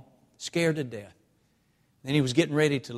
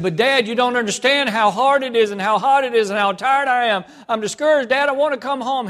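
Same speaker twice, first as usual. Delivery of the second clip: high, loud, breaking plea to come home; desperate pleading shout; 11 semitones higher, 13 dB louder than usual. Retold or imitated. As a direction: beg your dad at the top of your voice. Son desperately begs father on phone.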